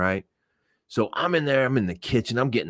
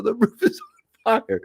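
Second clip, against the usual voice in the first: much higher, pressing urgency; urgent insisting. Retold or imitated. Friend insisting to him the roof really burns, urgent and high. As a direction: make him finally believe you.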